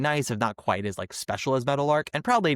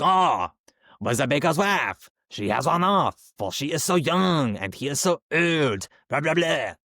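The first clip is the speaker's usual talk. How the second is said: slow, hammed-up French accent, higher pitched; drunken Lumiere, French accent; 4 semitones higher than usual. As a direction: imitate a tipsy Frenchman with an over-the-top accent. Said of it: "going full-blown drunken Lumiere".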